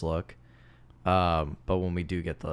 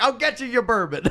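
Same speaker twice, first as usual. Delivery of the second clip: high, loud laughing quote; 5 dB louder than usual. Retold or imitated. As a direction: giggle through the line. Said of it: "It's like".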